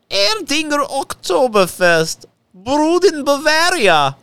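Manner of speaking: high, slow hammed-up German accent; over-the-top German beer ad voice. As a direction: belt the brand like a Bavarian pitchman. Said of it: "why are you putting on your lederhosen?".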